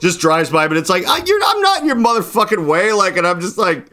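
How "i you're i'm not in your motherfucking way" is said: high-pitched mock indignant rant; retold cussing out. Friend cusses out honking driver in parking lot, indignant. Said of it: "he's just cussing this guy out".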